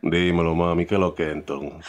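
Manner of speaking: put-on deep, low Dominican-accented pickup line; octave drop. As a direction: drop the voice low and smooth to flirt. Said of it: "The octave drop".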